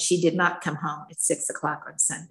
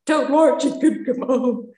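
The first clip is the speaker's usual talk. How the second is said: high, hammed-up wail; mock crying; 5 semitones higher, 4 dB louder than usual. Wife tearfully confessing to her husband, mock wailing. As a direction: imitate blubbering it out, pitched up. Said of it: "I cried".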